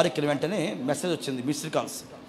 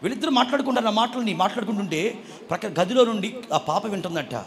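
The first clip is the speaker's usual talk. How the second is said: ordinary delivery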